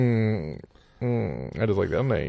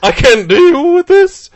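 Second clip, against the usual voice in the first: high, slow, strange sea creature voice; warbling underwater mermaid cry; 5 semitones higher, 8 dB louder than usual. Pretend. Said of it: "an excuse to do the voice again".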